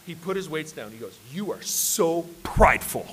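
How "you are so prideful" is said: slow, drawn-out, weighty reproach; mock grave rebuke. Brother putting weights down to rebuke him, mock grave. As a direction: imitate solemn disapproval, stretching each word.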